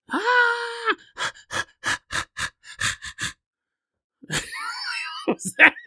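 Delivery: high-pitched yelp of contractions; labor cry. Acted. Comic imitating a woman's contraction cry. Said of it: "That was me imitating, like, contractions".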